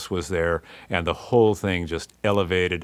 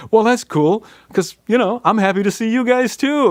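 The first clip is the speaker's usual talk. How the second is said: much higher, louder mock voice of Billy; Billy James voice; 7 dB louder than usual. Record exec answering the band in his office, high mock voice. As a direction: play the hip record exec, high and bright. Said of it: "And Billy says".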